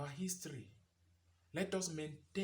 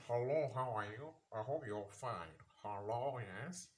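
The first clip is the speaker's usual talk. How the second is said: mock European-style accent, lower than usual; imitated foreign accent; 3 semitones lower than usual. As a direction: put on an affected foreign accent. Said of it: "the, the accent changes".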